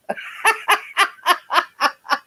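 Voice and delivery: high banshee-like cackle; rapid string of high ha-has; real cackle. Real, not acted. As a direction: let out a long high laugh. Laughing at banshee nickname.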